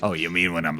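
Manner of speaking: usual speaking voice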